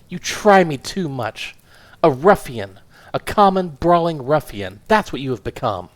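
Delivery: a small furious woman tearing into her husband; indignant wife. Acted. Wife scolding her husband for his scandals, indignant.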